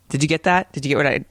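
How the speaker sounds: normal talking voice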